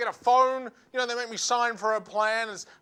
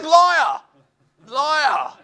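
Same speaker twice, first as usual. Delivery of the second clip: loud, high cry of liar; accusing shout; 5 semitones higher, 10 dB louder than usual. Retold or imitated. Comedian accusing the junkie in the street, shouting. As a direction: yell it like catching him out.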